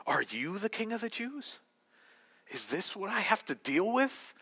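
mildly put-upon disbelief, lifting at the end; unimpressed, talking down; condescending Pilate. Acted. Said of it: "So we know he talked like this".

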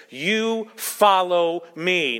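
slow, weighty "You", then "follow me" spaced out; emphatic YOU. Retold or imitated. Preacher quoting the command to Peter, emphatic YOU. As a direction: give the command word by word, stressing "You". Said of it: "so it's more emphatic".

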